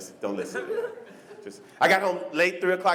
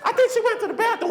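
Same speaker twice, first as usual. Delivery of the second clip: put-on high, laughing girl voice; girly answer; 12 semitones higher than usual. Friend telling the leader where Stephanie went, high girly voice. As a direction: answer sweetly.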